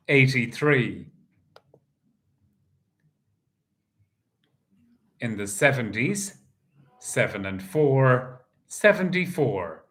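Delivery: steady sing-song number calling; bingo caller. Acted. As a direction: call each number out to the hall. Calls the bingo numbers live.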